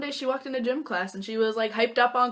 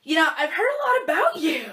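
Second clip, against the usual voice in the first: higher, louder bubbly imitation; imitating Seaham; 9 semitones higher, 7 dB louder than usual. Seaham introduces herself at the dance, bubbly imitation. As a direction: play the friendly stranger. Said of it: "And she said to me".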